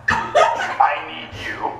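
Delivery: moaned. Acted. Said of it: "Hilda moaned".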